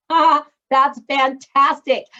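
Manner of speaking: slow, loud laughing reaction. Real, not acted. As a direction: laugh through the words at the funny title. Host laughing at book title.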